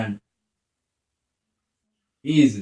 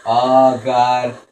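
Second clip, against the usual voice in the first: loud, raised panicked voice; scared pleading; 6 semitones higher, 6 dB louder than usual. Real, not acted. Player with an enemy right behind, pleading in fear. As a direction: plead in real fright, climbing higher. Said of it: "I'm fucking scared".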